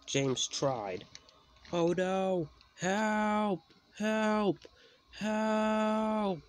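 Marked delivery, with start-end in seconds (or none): 1.7-6.4 high, drawn-out wails for help; long, high runaway screams; panicked cries. A narrator's reading. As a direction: wail each word in high panic.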